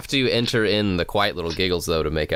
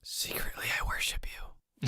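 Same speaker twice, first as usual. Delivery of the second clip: whisper; 13 dB quieter than usual. Pretend. Co-host secretly confessing worship to the guest, whispered. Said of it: "whisper into the microphone".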